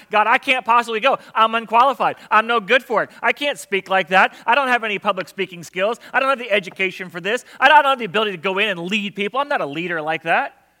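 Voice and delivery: loud, fast protest; Moses arguing. Acted. Moses arguing with God, loud. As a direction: argue your way out of it. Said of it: "trying to tell God".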